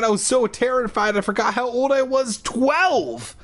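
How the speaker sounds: terrified blurt. A narrator's reading. Scared boy blurting his age to the stranger. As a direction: blurt it out in a panic.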